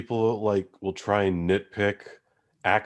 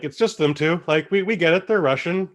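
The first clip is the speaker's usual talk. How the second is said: raised rant; 9 semitones higher, 5 dB louder than usual. Real, not acted. Ranting about half-hearted accents.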